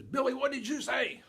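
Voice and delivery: high, loud scolding demand; mad mother. Retold or imitated. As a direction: shout it like a mother who just heard swearing. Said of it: "Mother then gets mad".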